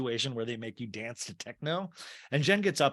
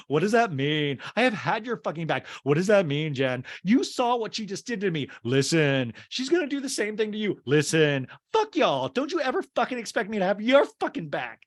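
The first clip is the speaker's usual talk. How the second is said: higher, heated mock argument; re-enacted angry retort; 6 semitones higher, 4 dB louder than usual. Acting out Lisa arguing back at Jen, angry. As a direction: fire back with loud, high bursts.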